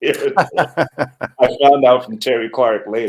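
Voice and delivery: loud, slow words through laughter; laughing. Real, not acted. Telling who his teacher's landlord was, laughing. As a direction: tell it through a big laugh.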